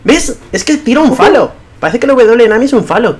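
high, loud, amused insistence; excited insisting. Real, not acted. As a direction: push the point, laughing inside. (insistiendo emocionado, agudo y alto)

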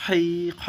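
Arabic kha from the throat; throaty kha. Shown as a demonstration. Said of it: "একটু ভিতর থেকে বের করে".

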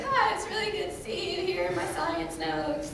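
high, mock-friendly greeting with a laugh; hiding fear. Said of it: "that you're kind of afraid of, but you don't wanna show fear".

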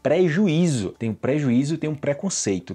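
ordinary talk

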